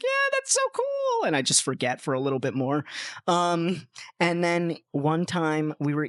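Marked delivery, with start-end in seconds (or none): 0.0-1.3 put-on very high, slow, starry-eyed kid voice; sing-song high awe as a young fan; naive kid voice. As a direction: gush it like an awestruck little kid. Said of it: "so I was like".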